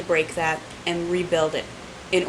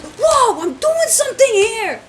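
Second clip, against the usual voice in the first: much higher, louder angry yell; angry shout; 6 dB louder, much slower than usual. Retold or imitated. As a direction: yell like he's been interrupted. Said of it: "when Keith gets mad, he goes".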